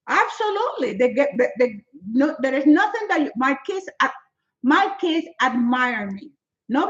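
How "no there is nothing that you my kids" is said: usual speaking voice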